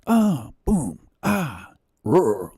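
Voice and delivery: high, falling mock fight noises; punchy video sound effects done by mouth; imitated punching sound effects. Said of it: "punching sound effects and music".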